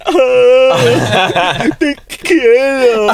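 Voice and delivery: fake-cry the line like a lover breaking down; mock sobbing. Teasing friend about crying during sex, hammed-up laughing sob. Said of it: "En plan".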